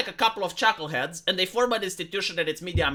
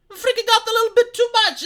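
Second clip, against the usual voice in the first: "freaking out a little bit too much" jumps much higher, louder and faster; repeating his warning to panicking viewers, higher and louder; exasperated repeat; 12 semitones higher, 5 dB louder, faster than usual. Retold or imitated. Telling panicking people to calm down, higher, louder and faster. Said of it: "Hey, you're freaking out a little bit too much".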